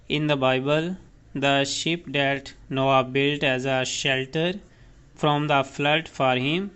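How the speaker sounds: the state of the Arizona said word by word, very slowly; very slow dictation. Shown as a demonstration. Teacher slowly dictates the meaning to students. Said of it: "dictating definition".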